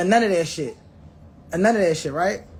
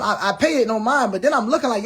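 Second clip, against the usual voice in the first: normal talking voice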